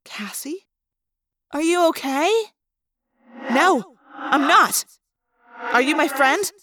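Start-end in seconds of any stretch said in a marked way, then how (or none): 3.5-6.5 echoing inner voice; distressed thought-speak. A narrator's reading. Lost Rachel questioning the girl, echoing distress. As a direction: project it with echo, pleading.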